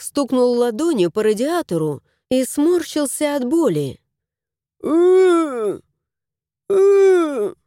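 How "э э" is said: high, loud whimper of pain; pained whine. A narrator's reading. The house spirit whines after hitting the radiator. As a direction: whine over a bruised hand. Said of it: "заныл он".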